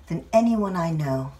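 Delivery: plain reading voice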